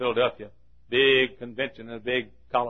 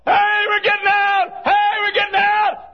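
high, sustained holler; jubilant calling out; 9 dB louder, slower than usual. Acted. Damned soul calling to others about release, jubilant shout. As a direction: shout the news across a crowd.

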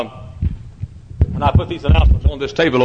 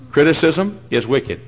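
usual speaking voice

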